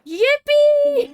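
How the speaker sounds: high loud yippee; excited cheer. Real, not acted.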